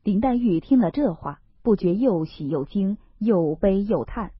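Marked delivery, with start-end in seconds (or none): none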